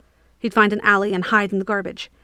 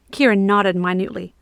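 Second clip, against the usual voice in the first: ordinary delivery, nothing marked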